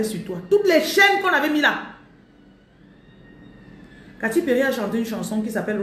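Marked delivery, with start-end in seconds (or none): 0.5-1.8 high, loud fervent proclamation; loud preaching cry; declaring shout. Real, not acted. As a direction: shout it like breaking chains. Preacher breaking chains declaration.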